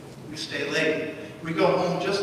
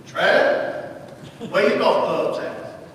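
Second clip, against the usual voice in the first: slow, easy Southern drawl; lightly voiced old Alabama man; Southern accent; much slower than usual. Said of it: "He had this thick Southern accent".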